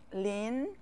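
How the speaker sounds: "Lin" drawn out with a rising pitch; Mandarin second tone. Shown as a demonstration. Teacher listing second-tone words for learners, "Lin" rising. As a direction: let "Lin" climb steadily to its end. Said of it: "how many words have the second tone".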